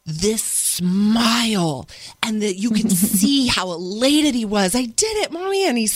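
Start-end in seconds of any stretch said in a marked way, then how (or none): 4.8-5.7 put-on higher, beaming little boy; proud child. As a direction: voice the kid thrilled he did it. Proud son voiced in story.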